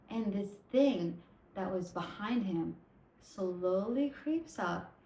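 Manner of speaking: ordinary talk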